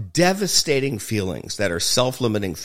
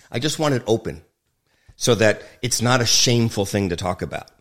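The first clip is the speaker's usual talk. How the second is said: ordinary delivery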